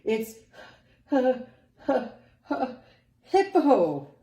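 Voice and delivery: high, loud, laughing gasps then the name; gasping laugh. Acted. Rhinoceros finally confessing his name, gasping with laughter. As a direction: squeeze the name out between laughs. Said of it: "gasped the rhinoceros".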